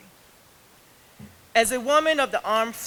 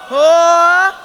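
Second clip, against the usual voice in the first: booming army hooah; loud gung-ho soldier; 8 semitones higher, 17 dB louder than usual. Real, not acted. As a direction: shout it out like a drill call. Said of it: "Can I get a big hooah for the Army".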